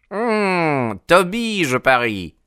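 high, knowing hum then guess. A narrator's reading. Old man guesses.

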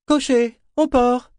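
loud, high call to a driver. A narrator's reading. Governor orders the coachman to the port, calling out loudly. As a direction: call out the order sharply upward. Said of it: "Il haussa la voix".

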